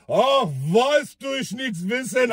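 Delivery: put-on stalling the question in a drunk Russian accent. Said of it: "Mit so einem russischen Akzent". (langsamer, tiefer russischer Akzent, ausweichend)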